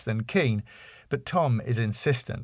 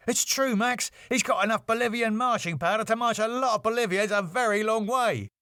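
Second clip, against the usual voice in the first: much higher, fast urgent Dave; 11 semitones higher than usual. A narrator's reading. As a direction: insist the news is true. Dave voiced in read script.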